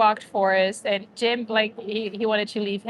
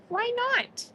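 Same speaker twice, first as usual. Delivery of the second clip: high, soft coaxing question; cooing at a dog; 14 semitones higher, 5 dB quieter than usual. Real, not acted. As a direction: coax the dog sweetly in a high voice.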